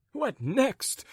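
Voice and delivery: high, affronted repeat; high-pitched indignant question; indignant echo. Acted. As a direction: throw the question back in offense. Gentleman echoes the curt question indignantly.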